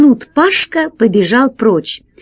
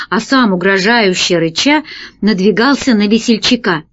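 usual speaking voice

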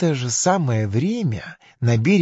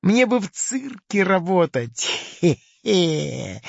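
self-pleased crocodile voice, raised in pitch; pitched-up crocodile with a hehe; loud crocodile; 6 semitones higher than usual. Acted. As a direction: boast with a little chuckle. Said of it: "громко сказал крокодил".

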